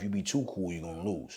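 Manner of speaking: normal talking voice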